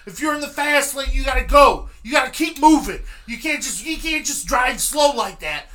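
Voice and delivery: imitating a furious state trooper; trooper yelling. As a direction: bark it like an angry cop chewing someone out. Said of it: "he let off again with the".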